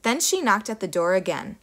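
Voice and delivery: usual speaking voice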